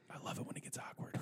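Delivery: unvoiced gleeful whisper. Teller whispering to girl after awkward joke, gleeful whisper. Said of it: "And I was like".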